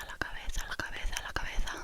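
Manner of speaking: la cabeza, la cabeza rattled off fast; whispered tongue-twister speed; quick repeat. Shown as a demonstration. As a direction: race through la cabeza twice. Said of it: "See how quickly I can say la cabeza?".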